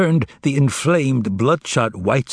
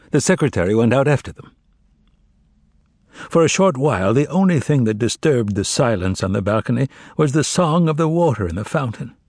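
usual speaking voice

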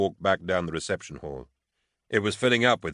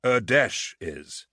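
slow, pointed correction, pitched up; scowling; 5 semitones higher, much slower than usual. A narrator's reading. Officer correcting a colleague about the war, scowling. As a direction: say it grimly with a scowl. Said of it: "Hark scowled".

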